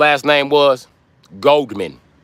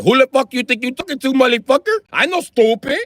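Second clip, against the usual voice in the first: angry doctor; 9 semitones higher, 4 dB louder than usual. Retold or imitated. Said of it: "the doctor was like".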